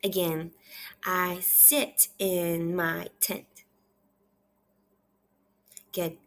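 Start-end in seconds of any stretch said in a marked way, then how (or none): 1.0-3.5 half-speed, word by word; measured repeat-after-me reading; slow read-aloud. Shown as a demonstration. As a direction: model each word separately for a young reader. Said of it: "I want you to repeat after me".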